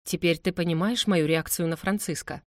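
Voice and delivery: plain reading voice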